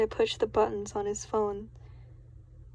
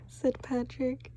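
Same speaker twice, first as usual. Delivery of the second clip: plain reading voice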